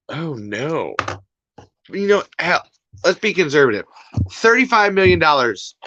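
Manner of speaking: ordinary talk, nothing marked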